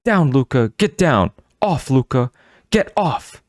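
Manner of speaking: firm shouted commands; booming commands. A narrator's reading. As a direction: bark the commands to get the beast off. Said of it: "Ivana's booming shouts".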